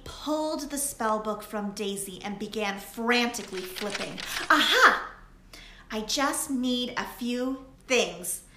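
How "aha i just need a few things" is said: triumphant aha. A narrator's reading. Lily finding the spell in the book, triumphant. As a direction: sound like she found it.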